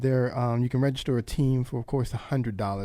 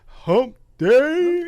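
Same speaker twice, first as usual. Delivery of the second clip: squeaky high cartoon camel voice; hammed-up high camel squeal; mock Hump Day call; 9 dB louder than usual. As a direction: pitch way up like the commercial camel. Mimics camel commercial.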